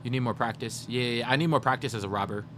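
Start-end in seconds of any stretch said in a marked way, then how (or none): none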